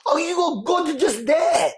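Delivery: high, slow teasing through laughter; laughing tease. Retold or imitated. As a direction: laugh as you mock the tattoo. She teases him, laughing.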